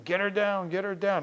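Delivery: high-pitched mock yell; retold screaming. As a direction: imitate an urgent crowd calling out. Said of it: "I heard them screaming".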